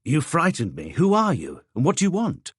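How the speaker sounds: a painter jolted by a stranger at his door; mildly startled outburst; startled exclamation. A narrator's reading. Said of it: "Claude exclaimed".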